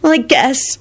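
mildly tearful, reluctant agreement; sniffy. A narrator's reading. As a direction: give in after crying. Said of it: "she sniffed".

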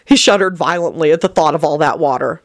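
ordinary delivery, nothing marked

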